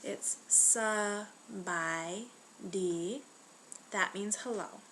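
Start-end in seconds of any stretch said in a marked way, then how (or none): 0.5-3.2 slow, syllable by syllable sabai di; slower for learners. Shown as a demonstration. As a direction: say "sabai" and "di" apart so the tones are heard. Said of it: "say it a little bit slower".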